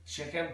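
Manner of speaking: "Chicken" with a throaty fricative K; Liverpool pronunciation. Shown as a demonstration. Teacher demonstrating Scouse, "Chicken" with hissed back-of-throat K. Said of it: "back of the throat with the ichen".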